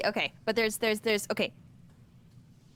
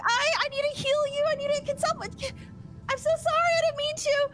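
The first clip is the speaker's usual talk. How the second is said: high, frantic stammering plea; panicked. Acted. Druid trying to heal her victim, panicking. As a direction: stumble over the words, pitched way up.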